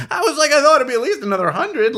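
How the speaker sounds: loud, high, laughing disbelief; loud high laughing retort; laughing outrage. Retold or imitated. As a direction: shout it through laughter. Retold reply, laughing and loud.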